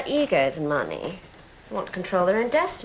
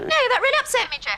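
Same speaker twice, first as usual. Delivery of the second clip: much higher, louder wounded cry; mock hurt; 5 dB louder, faster than usual. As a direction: overdo the hurt feelings.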